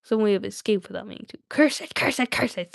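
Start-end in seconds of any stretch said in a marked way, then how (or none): 1.5-2.7 hissed, cursing Gollum voice; sibilant, spitting creature curse; Gollum hissing. A narrator's reading. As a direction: hiss it out as Gollum. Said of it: "hissed Gollum".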